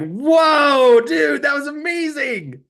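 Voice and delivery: high, loud amazed outburst; high-pitched "whoa, dude" disbelief; amazed shout. Retold or imitated. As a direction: blurt out your awe at the locksmith. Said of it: "And I was like".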